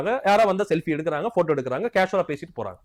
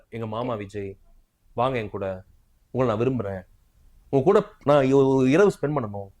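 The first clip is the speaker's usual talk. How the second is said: mocking flirty fan; 8 semitones lower than usual. Commentator mocks female fans' flirting, low and hushed. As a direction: mimic the fans' cooing invitation, hushed and low. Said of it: "இப்படி பேசுறாங்க".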